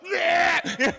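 high, loud laughing yeah. Retold or imitated. Boy reacting to the auntie's warning, laughing. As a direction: blurt it through a laugh. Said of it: "I'm like".

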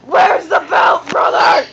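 loud, slow threat; menacing shout. Acted. Big brother demanding the belt, loud slow threat.

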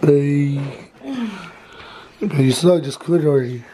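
ordinary delivery

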